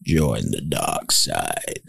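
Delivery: very low, slow, eerie drawl; low haunting voice. Acted. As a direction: menace them from the dark side. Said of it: "That was good".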